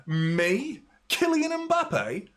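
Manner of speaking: mock outrage, climbing into very high disbelief; indignant complaining star. Mbappe complaining to the referee about a tackle, mock indignation. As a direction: put on an affronted, high-pitched whine of complaint. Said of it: "complaining, looking at his, looking at the referee".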